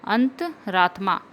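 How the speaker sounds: usual speaking voice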